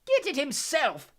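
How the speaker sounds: high, slow, mocking shriek; shrieking Bellatrix. A narrator's reading. Bellatrix mocking Harry's question, shrill shriek. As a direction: shriek it back at him in mad disbelief. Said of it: "shrieked Bellatrix on a cackle".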